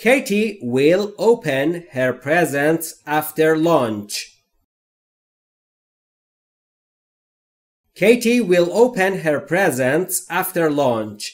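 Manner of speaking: very slow, careful dictation read; each word spaced out and clear; slow model reading. Shown as a demonstration. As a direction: read it slowly for learners to copy. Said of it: "حالا نوبت شماست".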